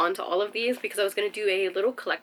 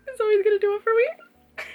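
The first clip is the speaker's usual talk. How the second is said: much higher and louder gushing declaration; excited gush; 12 semitones higher, 7 dB louder than usual. Real, not acted. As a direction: proclaim your favorite with glee. Declaring favorite character.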